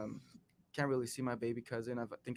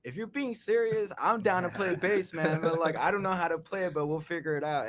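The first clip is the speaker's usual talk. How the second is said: excited; 5 semitones higher, 6 dB louder than usual. Retold or imitated. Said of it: "And I was just like".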